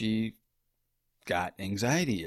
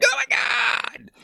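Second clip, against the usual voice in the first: very high, drawn-out mock scream; inner scream; 7 dB louder, slower than usual. Husband voicing his inside reaction to pregnancy, high screaming excitement. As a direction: squeal it out loud at a huge pitch. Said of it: "on the inside".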